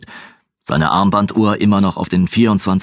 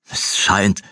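bitter repeat. Acted. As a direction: repeat "Es scheint" dryly.

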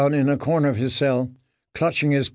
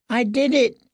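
high, thin whimpered confession; frightened mumble; 11 semitones higher than usual. A narrator's reading. Prisoner confesses to the boys, frightened high mumble. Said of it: "he mumbled".

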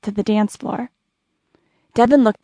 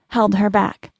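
usual speaking voice

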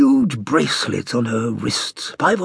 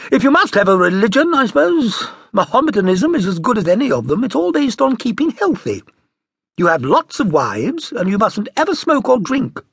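mother's voice; 9 semitones higher, 5 dB louder than usual. A narrator's reading. Mother explains religion.

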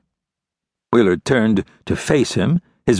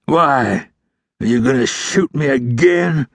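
higher, strained, mildly angry question; 6 semitones higher, slower than usual. A narrator's reading. Wounded outlaw challenging the ranger who shot him, angrily. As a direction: challenge him through the pain. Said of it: "Wheeler asked angrily".

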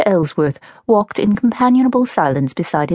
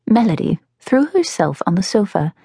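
usual speaking voice

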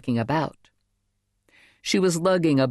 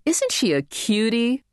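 cooing; 9 semitones higher than usual. A narrator's reading. Crowd cooing at baby.

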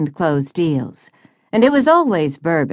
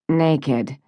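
usual speaking voice

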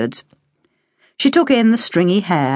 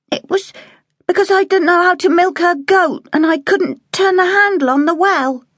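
put-on higher, small child voice; little girl. A narrator's reading. Girl telling the cook why she was sent away. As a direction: explain the goat and well sadly.

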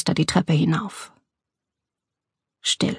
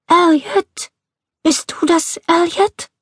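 much higher, thin-voiced questioning call; old woman calling; 11 semitones higher, slower than usual. A narrator's reading. Old woman calling to someone in the dark house, high uncertain voice. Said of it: "als eine Stimme sie erschreckte".

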